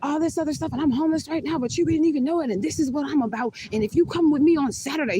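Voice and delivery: retelling the date yelling at her in a mocking high voice. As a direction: shout it up high like him losing it. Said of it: "he's yelling at me".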